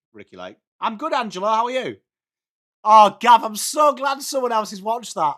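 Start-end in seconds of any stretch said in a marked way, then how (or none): 0.1-0.6 "Ricki Lake" said low and very soft, almost under the breath; muttered aside. Real, not acted. Streamer repeating a chat name to himself, muttered. 2.8-5.3 loud, high burst of delight; thrilled someone shares the taste. Real, not acted. Streamer to chatter Gav, delighted.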